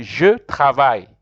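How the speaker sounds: teacher presenting "Je travaille" to the class; French model phrase. Shown as a demonstration. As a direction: say "Je travaille" loudly and clearly for learners. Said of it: "So you see".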